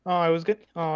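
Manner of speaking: normal talking voice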